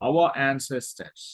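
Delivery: usual speaking voice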